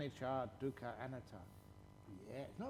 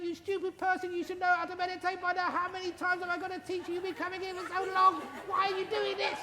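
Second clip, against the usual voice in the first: much higher and louder, hammed-up nagging teacher; mock angry scolding; 10 dB louder than usual. Shown as a demonstration. Teacher acting out a scolding to show how to anger people, hammed-up high rant. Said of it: "You start raising the pitch of your voice and you start saying".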